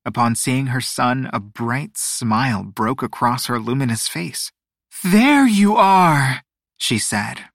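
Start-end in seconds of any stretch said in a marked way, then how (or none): 5.0-6.4 high, slow, relieved sing-song; lilting and relieved mother voice. A narrator's reading. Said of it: "relief in her sing-song voice".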